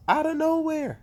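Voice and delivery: high and loud, thrilled; hyped. Real, not acted. Fan hyping the surprise return, excited. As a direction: sell the surprise entrance. Hyped reveal of Roman Reigns.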